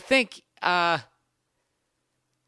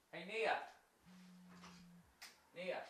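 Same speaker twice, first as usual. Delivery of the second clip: calling out; 28 dB quieter than usual. Real, not acted. Calls girlfriend from another room.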